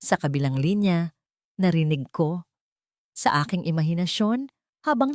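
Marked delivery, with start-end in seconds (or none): none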